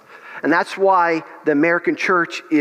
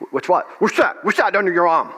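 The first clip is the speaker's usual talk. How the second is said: imitating a raw English accent, pitched higher; demanding in a rough English accent, voice raised higher; Smith Wigglesworth's raw English accent; 3 semitones higher than usual. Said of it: "real raw English accent".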